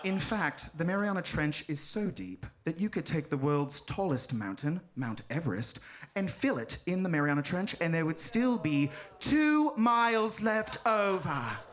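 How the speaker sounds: measured nature-film narrator voice; documentary grandeur, slowing on the last words. Acted. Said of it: "The narrator continues. He goes".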